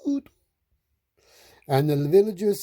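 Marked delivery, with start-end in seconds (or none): 0.0-0.3 short, light train toot; tiny clipped toot. A narrator's reading. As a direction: give a quick cheery peep of a whistle.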